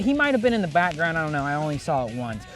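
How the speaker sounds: ordinary talk, nothing marked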